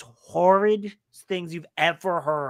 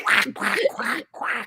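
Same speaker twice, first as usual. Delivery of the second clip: failed duck-voice quacks, breathy on the last; mock Donald Duck quacking; 6 semitones higher, slower than usual. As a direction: try a cartoon duck quack and fizzle out. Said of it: "Sorry, I can't do it".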